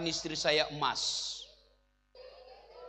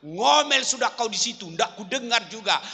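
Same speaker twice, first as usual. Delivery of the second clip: gloating husband; 4 semitones higher, 6 dB louder than usual. Retold or imitated. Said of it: "dia dorong istrinya".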